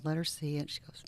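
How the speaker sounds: normal talking voice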